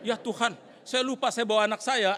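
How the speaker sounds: loud, high retold dismay; alarmed realisation. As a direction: exclaim as if caught out. Sudden realisation his child is there. (seruan kaget baru tersadar)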